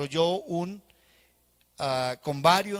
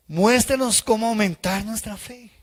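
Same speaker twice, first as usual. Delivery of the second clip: higher, louder, slow plea; pleading apostles; 5 semitones higher, 8 dB louder than usual. Acted. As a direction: beg the Lord for more faith. (súplica alta y fuerte de los apóstoles)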